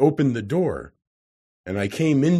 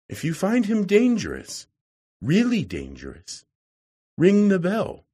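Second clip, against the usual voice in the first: higher, earnest voice of a worried wife; lightly voiced lady giving a warning; anxious warning wife; 6 semitones higher than usual. A narrator's reading. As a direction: urge caution, pitch lifted. Professor's wife warns the visitor.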